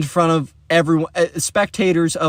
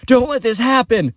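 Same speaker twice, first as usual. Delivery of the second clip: high-pitched urgent plea from friends; urgent egging on; 8 semitones higher than usual. Retold or imitated. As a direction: urge a friend to rush in and stop it. Friends urging Alex in story.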